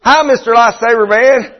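lightly voiced Gomer Pyle, folksy and chipper; a hint of a naive country greeting; Gomer Pyle line. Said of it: "he'd say".

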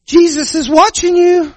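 high squawky parrot. Acted. Parrot warning the robber in a high squawk. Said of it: "The parrot just kept on".

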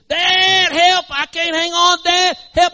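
high, loud panicked plea; desperate hollering. Acted. Boy slipping off the cliff to his dad, desperate hollering. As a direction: cry out while losing your grip. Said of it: "he starts hollering".

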